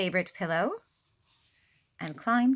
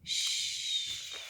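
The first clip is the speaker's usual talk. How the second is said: shushing; 6 dB quieter than usual. Real, not acted. Hushing listeners after sleep.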